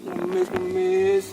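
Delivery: high, loud, drawn-out pleading cries; a beggar crying out to passers-by for money; loud begging calls. Acted. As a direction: holler for handouts like a street beggar.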